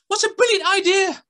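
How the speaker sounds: put-on high, gushing little girl; girl gasping at how festive it is; excited girl voice. A narrator's reading. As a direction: squeal it like a delighted girl. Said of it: "said the girl".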